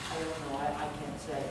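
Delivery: low, very soft reply; subdued helpless answer. Retold or imitated. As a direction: drop right down, quietly admitting you have no answer. Pastor's helpless answer to mourners.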